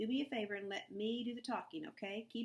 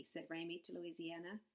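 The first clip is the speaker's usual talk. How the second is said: plain reading voice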